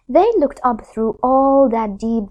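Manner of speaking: plain reading voice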